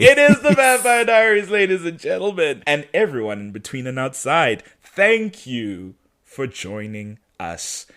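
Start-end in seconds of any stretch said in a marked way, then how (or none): 0.0-2.6 loud, high mock-grand announcement; showy announcer. Host echoing co-host to the audience, grandly announcing. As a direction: proclaim it to the crowd, high and loud.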